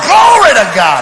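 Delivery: loud, high hallelujah cry; triumphant shout. Retold or imitated. Dad vindicated by the commercial, loud triumphant cry. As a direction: whoop it out in mock victory.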